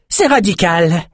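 high, pleased raider voice; high, excited raider cry. A narrator's reading. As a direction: cheer the catch. Raider cheering the catch.

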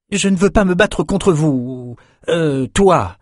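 high, pleading youth's voice; reluctant young fighter, pitched well up; protesting young man. A narrator's reading. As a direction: protest high, then correct yourself. Youth refuses to fight his mentor.

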